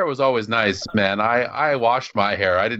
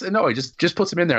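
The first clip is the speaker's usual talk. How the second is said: usual speaking voice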